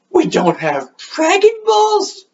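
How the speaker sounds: gasp, then high, drawn-out disbelief; hammed-up horrified squeal, dragging the words; mock shock. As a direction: react in exaggerated high-pitched alarm. Gohan acted panicking at lost Dragon Balls.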